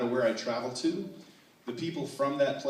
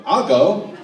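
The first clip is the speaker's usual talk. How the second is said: loud, higher-pitched butting in; eager interjection; 5 semitones higher, 11 dB louder than usual. Retold or imitated. Hurried customer cutting into a polite standoff, loud and high. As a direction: jump in loudly over them.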